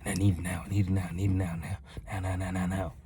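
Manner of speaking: soft, low rapid-fire muttered nagging; mock the impatient inner voice, under the breath; impatient demands.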